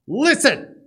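high, very loud attention-grabbing cry; commanding shout. Acted. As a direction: jolt the listener with a sudden shout. Acts out jolting listener to attention.

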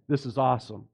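ordinary delivery

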